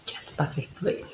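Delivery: a quick breathy whisper to the camera; whispered aside. Real, not acted. Travel vlogger whispering to her viewers before starting. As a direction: drop to a whisper for the last words.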